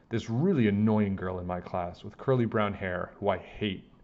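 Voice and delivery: plain reading voice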